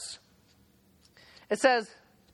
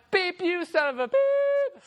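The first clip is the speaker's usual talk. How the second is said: high, slow angry yelling; cussing out; 8 semitones higher, slower than usual. Retold or imitated. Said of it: "I'm like".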